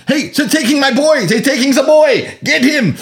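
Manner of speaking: high, loud German doctor yelling; doctor shouting. Retold or imitated. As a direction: shout in panic as the boy is taken. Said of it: "the doctor saying".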